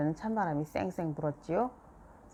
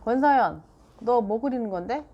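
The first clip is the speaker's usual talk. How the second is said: louder mom calling her daughter's full name; sharp call; 5 semitones higher, 6 dB louder than usual. A narrator's reading. Mom calls Seoyeon over her drawing, sharp and loud.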